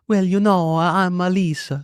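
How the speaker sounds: slow, lilting put-on Italian; Italian accent. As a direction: imitate your wife attempting an Italian accent. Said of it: "put on this Italian accent".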